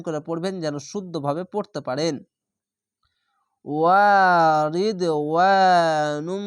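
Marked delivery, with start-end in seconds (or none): 3.6-6.5 very slow, loud, ringing chant of Warid ওয়ানুম; sung Arabic recitation; Quran recitation. Shown as a demonstration. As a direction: recite as if in a mosque, long and full. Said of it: "এক আলিফ টেনে পড়বেন".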